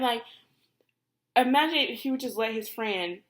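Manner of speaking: normal talking voice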